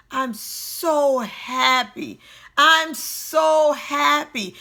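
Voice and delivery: drawn-out SO, slowly building in force; building insistence. Retold or imitated. Repeating it to themselves a hundred times, building insistence. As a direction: stretch "so" long and hit "happy" harder each time.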